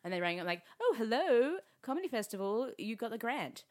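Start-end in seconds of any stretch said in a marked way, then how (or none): none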